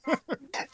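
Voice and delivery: short high-pitched laugh. Real, not acted. Laughs at own joke.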